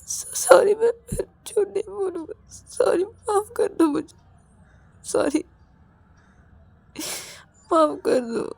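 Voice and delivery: high, whimpering plea; tearful apology. Acted. Ravi begs Rahul's forgiveness, crying and whimpering. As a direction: beg forgiveness through choked sobs. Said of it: "रो क्यों रहे हो?".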